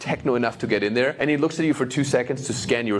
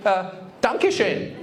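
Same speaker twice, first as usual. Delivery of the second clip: wife's halting German; 7 semitones higher, much slower than usual. Retold or imitated. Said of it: "she goes up to my mom".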